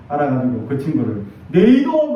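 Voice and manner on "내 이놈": 높고 크게 혼내는 척; 아이를 야단치듯 버럭 소리 높여; 호통치는 흉내. Retold or imitated. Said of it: "하고 막 혼내면서". (야단치는 호통 재연)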